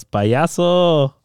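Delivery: high, drawn-out mocking call; retold yell. Podcaster retelling Chiellini's heckle as he passes, a mock yell. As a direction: imitate a heckler yelling in passing. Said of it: "and he yells".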